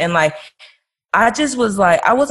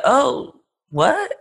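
mock surprise; 6 semitones higher than usual. Reacting to his going to sleep, mock surprise. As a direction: replay a taken-aback reaction, higher and rising.

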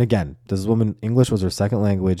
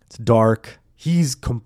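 normal talking voice

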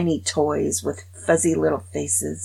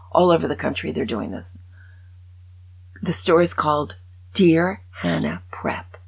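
ordinary delivery

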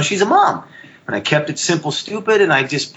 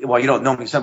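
usual speaking voice

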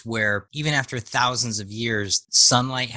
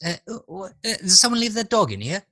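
confused character voice; 9 semitones higher, 3 dB louder, faster than usual. Retold or imitated. Acting out confused character line.

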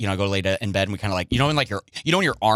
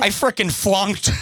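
mock bummed; 7 semitones higher, slower than usual. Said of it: "You made this sound".

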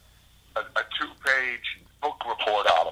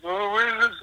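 much higher squeaky imitation of him; high mock voice; 12 semitones higher than usual. Asking about the poster in a squeaky imitating voice. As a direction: squeak it up high like a baffled mate. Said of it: "And he goes".

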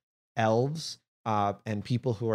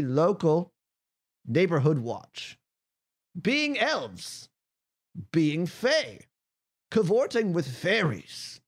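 high, slow proclamation voice; crier reading charges; 7 semitones higher, slower than usual. Acted. Crier reads the charges to five prisoners, high slow proclaiming. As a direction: read out charges slowly, pitched well above normal. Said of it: "out and say".

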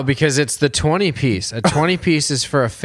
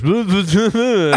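higher-pitched fake French babble; mock French gibberish; 6 semitones higher, slower than usual. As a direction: imitate unintelligible French. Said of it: "I don't know the fuck French".

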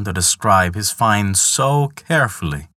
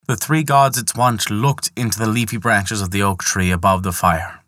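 plain reading voice

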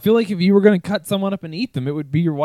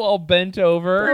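normal talking voice

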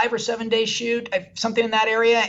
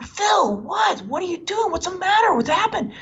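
the producer, high and urgent, worried for him; alarmed concern; 11 semitones higher than usual. Retold or imitated. Producer finding Phil crying on the curb, high alarmed concern. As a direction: call out to him, pitched up in alarm.

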